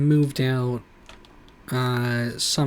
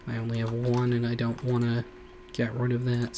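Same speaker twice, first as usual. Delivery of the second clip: ordinary delivery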